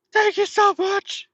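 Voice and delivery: very high, slow, gushing thanks; overjoyed thanks. Retold or imitated. Trucker thanking the cashier for free coffee, gushing very high. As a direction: gush it out way up high, overwhelmed.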